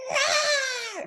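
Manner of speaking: very high, thin cub roar; little cub's roar. Acted. Little guy copies the big lion, high-pitched roar. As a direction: squeal out a baby lion's roar. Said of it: "the little guy goes".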